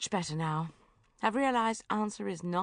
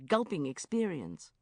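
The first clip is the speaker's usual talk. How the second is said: ordinary delivery, nothing marked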